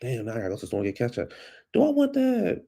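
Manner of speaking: ordinary talk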